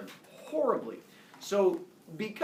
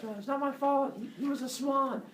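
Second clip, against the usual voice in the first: higher, pleading whine; whiny protest; 9 semitones higher than usual. Acted. Leto insisting to Hera that it was the swan, whining. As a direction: plead your innocence like a caught child.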